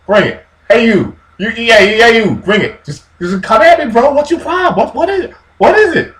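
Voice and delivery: high, loud taunting bully voice; mock giant's high jeering taunt; trash-talking giant. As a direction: jeer at them like a cocky brute. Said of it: "he's just talking smack like".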